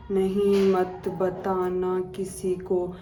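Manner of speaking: मत बताना किसी को drawn out slowly, word by word; slow creepy text. Retold or imitated. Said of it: "ऐसा टेक्स्ट कर रही है". (धीमे धीमे डरावना संदेश)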